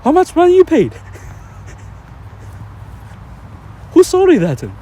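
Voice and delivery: hammed-up shock at an absurd claim; mock disbelief. Acting out disbelief at tourist.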